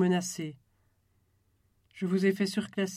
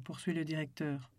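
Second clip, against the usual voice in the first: usual speaking voice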